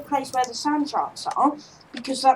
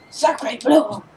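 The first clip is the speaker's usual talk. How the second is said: put-on French accent; 4 dB louder than usual. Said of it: "I like saying sacre bleu".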